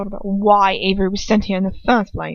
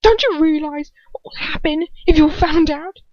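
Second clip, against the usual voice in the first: higher, louder frightened plea; anxious Draco; 9 semitones higher, 7 dB louder than usual. Acted. Draco warning his father, high and anxious. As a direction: urge him to see the danger.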